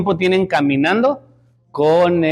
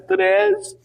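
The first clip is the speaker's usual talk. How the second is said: put-on small child answer; 14 semitones higher than usual. Child answers how many owed.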